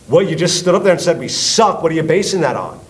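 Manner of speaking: loud, high-pitched demand; retold angry confrontation; indignant challenge. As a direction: call him out on the insult. Acted quarrel with the insulter.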